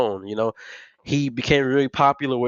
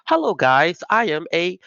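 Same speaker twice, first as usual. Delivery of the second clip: higher, stilted mock regular-person voice; safe mainstream voice; 4 semitones higher than usual. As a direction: imitate a put-on, overly proper speaking voice. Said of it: "then she switches over to".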